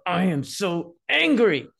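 acted child anger. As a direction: voice a fed-up kid stomping out the words. Child voiced venting anger.

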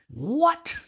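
high-pitched incredulous yelp; stunned disbelief. Retold or imitated. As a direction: act out shock, pitch leaping up. Retells reaction to cocaine accusation.

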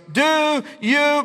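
high, loud mock holler. Roommate hollering at the new foreign student. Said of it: "he's hollering at me".

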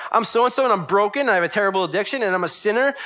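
high, loud run of admissions; bold acted confession. Churchgoer owning up to being broken, loud and bold. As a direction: rattle off your faults unashamed. Acted believer admits faults boldly.

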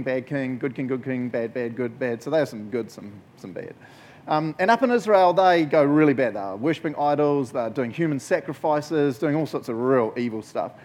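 ordinary delivery

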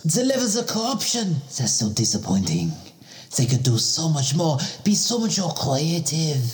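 a Frenchman lamenting lazy corruption; comic French accent. Acted. Same French-accented character.

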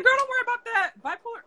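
a high, easygoing put-on voice for the fellow patient; breezy reassuring friend. Fellow patient comforting her in hospital, high breezy reassuring voice. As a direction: brush her worry off, light and breezy.

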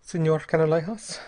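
polite, slightly lifted form of address. A narrator's reading. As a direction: open with a courteous address. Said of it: "said Bell politely".

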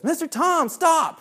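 very high imitation of a child; child's voice. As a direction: mimic a kid piping up to the teacher. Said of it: "child sitting close to me says".

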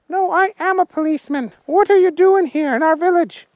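high, loud little policeman voice; Willie the policeman. Acted. Policeman Willie stopping the humans, high and loud. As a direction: bark orders as a tiny policeman.

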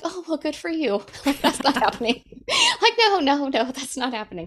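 high, laughing mock politeness turning to no; amused, high-pitched brush-off; laughing refusal. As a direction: chuckle through a firm no way. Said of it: "I was like".

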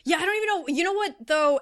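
exasperated. Real, not acted. Exasperated reply to co-hosts' joke.

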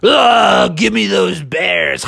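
growling troll menace; hammed-up monster threat; grabby troll. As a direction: snarl it like a storybook troll. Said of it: "troll was reaching up his hand saying".

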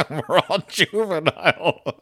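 high, laughing through the words. Real, not acted. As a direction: crack up at your own joke. Laughs at own joke.

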